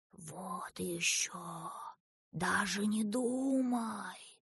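soft, very slow snake voice; rustling snake. A narrator's reading. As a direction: rustle the words out, hushed and drawn out. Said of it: "прошелестел королевский уж".

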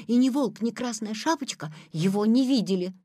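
ordinary delivery, nothing marked

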